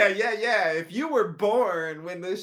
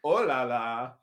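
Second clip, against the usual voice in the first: mock French exclamation; playful French accent; 3 semitones lower, 4 dB louder than usual. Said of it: "French pronunciation".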